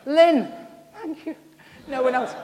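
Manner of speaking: high, loud exclamation of a name; sudden loud, high-pitched shout; delighted call-out. Real, not acted. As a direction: call out in mock amazement across the room. Calling on one raised hand in congregation.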